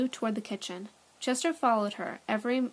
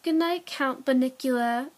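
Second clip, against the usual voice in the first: loud, higher mock-grand good night; sarcastic; 4 semitones higher, 6 dB louder than usual. A narrator's reading. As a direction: say it with mocking ceremony. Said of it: "Pete said sarcastically".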